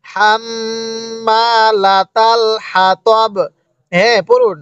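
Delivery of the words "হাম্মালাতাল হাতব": long hummed double m in হাম্মালাতাল; slow tajweed reading. Shown as a demonstration. Teacher showing how to read with a stop, long nasal hum in হাম্মালাতাল. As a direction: stretch হাম্মালাতাল on a held nasal m, stop crisply on হাতব. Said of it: "এরপরে পড়ুন".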